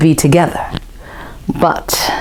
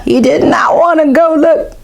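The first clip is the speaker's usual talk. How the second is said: high, fearful; mock scared; 10 semitones higher than usual. Boy scared to go look, high and fearful. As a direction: pull back from the dark. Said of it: "repeated, scared".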